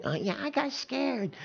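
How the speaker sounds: high, soft mock girl; light teasing falsetto; girly voice. Preacher imitating girls admitting fear. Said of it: "girls will readily admit".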